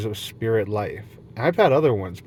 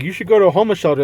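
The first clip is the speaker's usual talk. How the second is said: very fast, higher and louder; rattled-off advice; 7 semitones higher, 7 dB louder, much faster than usual. Retold or imitated. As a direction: rush it out like nagging advice. Imagined advice from people.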